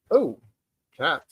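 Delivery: ordinary talk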